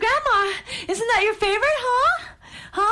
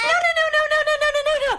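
rapid string, raised pitch; head off the tears in a hurry; alarmed soothing; 4 semitones higher, much faster than usual. Acted. Big sister stopping toddler's panic, alarmed repetition. Hurriedly calming frightened toddler.

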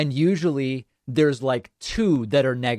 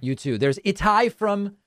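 normal talking voice

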